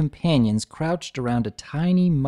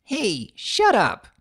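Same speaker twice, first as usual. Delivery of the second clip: embarrassed snap; 11 semitones higher, 3 dB louder, much slower than usual. Acted. Scootaloo embarrassed at teasing.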